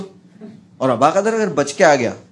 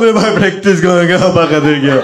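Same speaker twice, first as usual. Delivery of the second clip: mock crying; 2 semitones higher, 8 dB louder than usual. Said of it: "वो रोते रोते बोल रहा है".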